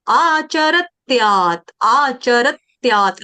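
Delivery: आचरत्यात् slowly, half त touched after र; slow pronunciation demo. Shown as a demonstration. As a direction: give आचरत्यात् a light half त. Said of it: "र के बाद भी आधे त का टच देना है".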